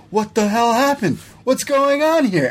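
high, startled, slowing on the second question; mock shock; shocked outburst. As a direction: act out a stunned wrestler walking into a mess. Adam Cole finds teammates attacked.

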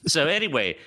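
ordinary talk

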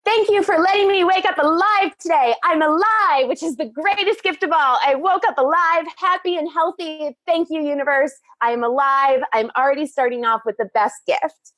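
high, gushing grateful voice; bubbly upbeat fitness guru; Richard Simmons impression. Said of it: "he's like, I say".

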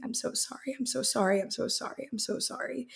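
hushed, high and tearful, nearly breathless; a father barely able to speak through grief; dad's broken apology. Retold or imitated. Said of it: "my dad saying".

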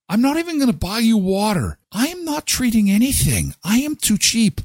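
a light hint of a proud tightwad; playful cheapskate. Host jokingly refusing to buy anyone water, playful.

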